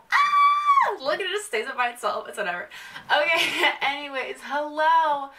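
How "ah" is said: sudden high shriek of surprise; startled shriek. Real, not acted. Vlogger shrieks at a stick that stays up. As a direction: yelp in sudden delight.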